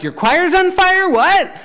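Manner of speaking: a puzzled person on the phone mishearing; confused listener. Retold or imitated. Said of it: "And they're like".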